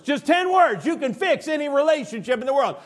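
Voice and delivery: high, loud preacher's proclamation; full-force preaching. Real, not acted. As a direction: preach it out at the top of the voice.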